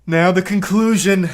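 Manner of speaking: loud, slow hype; excited announcement. Real, not acted. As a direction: call it out to chat, building it up. Hyping the final part.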